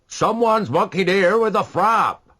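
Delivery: high, loud, slow angry shout. A narrator's reading. A man in the boats shouting up to the quay. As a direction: call up the bad news. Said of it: "shouted one of the men".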